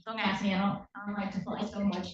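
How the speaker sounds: ordinary delivery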